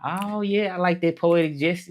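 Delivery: high-pitched, delighted "oh yeah"; light up with pleasure, voice jumping high; pleasantly thrilled, pitched well up; excited approval. Real, not acted. Pleased reaction to a comment.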